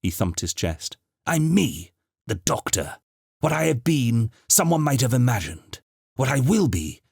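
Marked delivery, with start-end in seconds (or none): 1.2-7.0 impassioned declaration. Acted. Doctor declares who he is.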